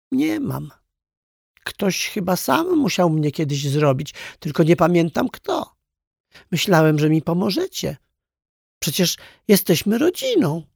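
high, small, dejected toy voice; dejected little clown. A narrator's reading. Clown hanging his head before the toys, dejected. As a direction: appeal in a high, crestfallen voice. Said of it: "Pajacyk zwiesił głowę".

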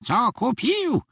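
high, screechy question from the till; high-pitched squawk of a shop owner; screeching shop owner. A narrator's reading. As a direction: screech it like a stingy old shopkeeper. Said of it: "zaskrzeczała z zakasy sucha, żółta właścicielka sklepu".

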